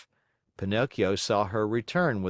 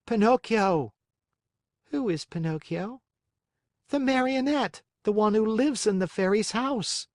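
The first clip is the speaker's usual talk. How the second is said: Pinocchio voice; 12 semitones higher than usual. Acted. Pinocchio and snail trading lines.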